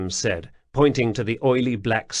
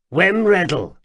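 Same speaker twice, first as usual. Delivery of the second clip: high, loud jittery stranger; pleading, flustered little man; nervous odd man voice; 8 semitones higher, 6 dB louder than usual. A narrator's reading. Wemreddle names himself.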